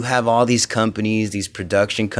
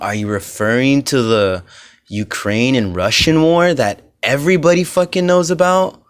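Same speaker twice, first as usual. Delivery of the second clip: usual speaking voice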